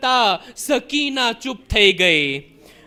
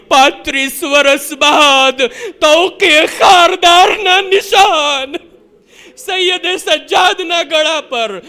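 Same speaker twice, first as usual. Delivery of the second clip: preaching at full force; 3 semitones higher, 4 dB louder than usual. Acted. Preacher to mourners, full-force lament. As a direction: drive the grief home to the crowd.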